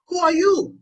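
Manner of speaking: high, loud demand; acted bewildered challenge; startled questioning. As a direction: demand to know who is there. Isaac challenges the unknown son.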